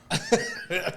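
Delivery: sudden high laugh. Real, not acted. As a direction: let out a quick laugh. Laughs while retelling the story.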